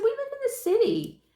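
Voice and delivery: high and emphatic. Retold or imitated. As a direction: raise pitch to make the point. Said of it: "I said".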